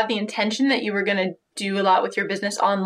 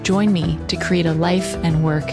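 measured, low-key intro narration; podcast intro read; 3 semitones lower than usual. Acted. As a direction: read it like a show opener. Scripted podcast intro over music.

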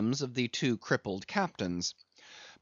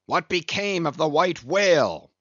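louder, higher demand from Ahab; impatient cry; 5 semitones higher, 5 dB louder than usual. A narrator's reading. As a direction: cut through the banter with the question. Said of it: "now cried Ahab".

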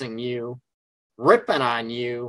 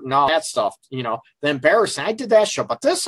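ordinary delivery

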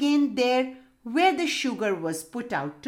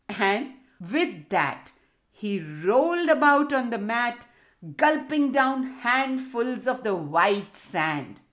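usual speaking voice

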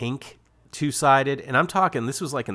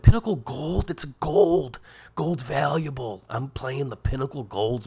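higher, put-on kid voice, dazzled by gold; naive excited kid; 4 semitones higher, slower than usual. Kids wowed by gold golf balls, mock dazzled. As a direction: gush like a kid who thinks gold means valuable. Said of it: "We were like".

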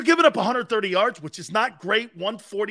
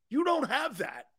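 usual speaking voice

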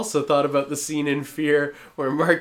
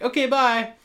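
abrupt high bye; 13 semitones higher, 5 dB louder than usual. Retold or imitated. Retelling a weird customer's goodbye.

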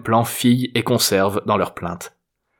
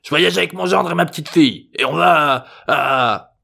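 high grandpa voice, stalling at the end; blustering old man; 7 semitones higher, 3 dB louder than usual. A narrator's reading. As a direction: bluster along, then drag out the missing word. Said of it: "lui souffla Jérôme".

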